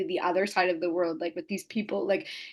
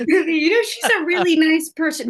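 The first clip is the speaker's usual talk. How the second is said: laughing backtrack; 8 semitones higher, 8 dB louder than usual. Acted. Joking backtrack, loud laughing.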